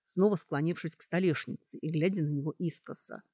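plain reading voice

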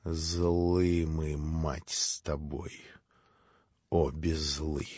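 low, soft, poisoned with spite; venomous voice. Acted. Grushenka answers Katya with soft, low venom. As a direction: drip quiet venom at her rival. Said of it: "ядовитым, отравленным злобой голосом".

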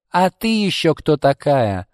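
acted hostile challenge; snarling challenge. A narrator's reading. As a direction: confront the intruder aggressively. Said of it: "Марго оскалилась, словно бешеная собака".

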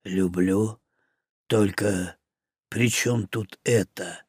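wounded orc answering Мух, low rumble; rumbling. Acted. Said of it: "пророкотал".

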